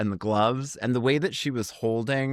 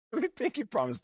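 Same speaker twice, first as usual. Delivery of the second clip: put-on Jamaican accent; 12 semitones higher, 5 dB quieter than usual. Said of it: "That was a Jamaican accent".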